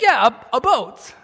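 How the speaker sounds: imitated Wisconsin long o, pushed loud; Wisconsin accent. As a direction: confirm it emphatically as the Wisconsin friend. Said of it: "And he's like".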